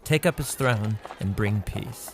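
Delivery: ordinary talk, nothing marked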